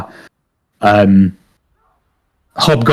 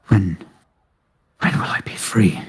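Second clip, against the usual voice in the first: frightened whisper; 4 semitones lower, 7 dB quieter than usual. Acted. Scared captive woman pleads quietly.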